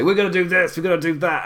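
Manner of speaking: America as leader. Acted. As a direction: put on an assertive leader announcing plans. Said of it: "it would be like America would say".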